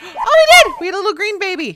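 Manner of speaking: loud, high burst of joy; loud delighted squeal; thrilled cry. Real, not acted. Baby is born.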